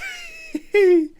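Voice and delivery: high-pitched cackle in character; uncontrollable laughing. Acted. Boss cracks up over the horrible farm, high giggle. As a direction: break into a villain's helpless giggle. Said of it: "breaks down in uncontrollable laughing".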